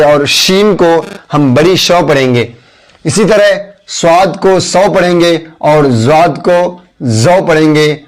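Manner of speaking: ordinary talk, nothing marked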